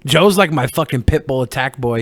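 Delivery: normal talking voice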